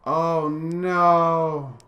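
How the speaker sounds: loud, high, drawn-out cry of dread; alarmed wail. Real, not acted. Player dreading a big fight, alarmed wail.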